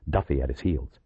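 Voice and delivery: usual speaking voice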